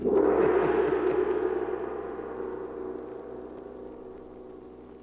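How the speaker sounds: long airy sinister chuckle; eerie breathy laugh. Acted. Host laughs eerily announcing Devil Island. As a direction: laugh like a ghost fading.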